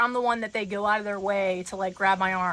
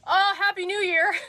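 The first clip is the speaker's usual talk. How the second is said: imitating a flustered man, pitched higher; stunned flustered guy; 9 semitones higher, 3 dB louder than usual. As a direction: blurt it out, dazzled and flustered. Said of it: "so stunned by me that he goes".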